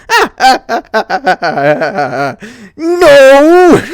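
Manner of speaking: high cackling ah ah, then loud no; taunting laugh. A narrator's reading. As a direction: laugh in her face. Spike laughing.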